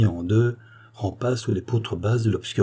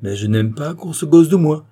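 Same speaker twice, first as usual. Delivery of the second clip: raised, high-pitched indignation; angry protest; 6 semitones higher, 5 dB louder than usual. A narrator's reading. Visitor striking his stick at the girl's feet, indignant outburst. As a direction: lay down the law, heated. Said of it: "en frappant le sol de son bâton".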